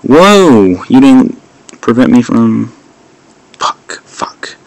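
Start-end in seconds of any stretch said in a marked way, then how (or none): 3.6-4.6 whispered curse. Real, not acted. Muttered curse.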